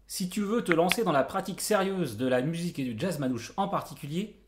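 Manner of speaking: ordinary talk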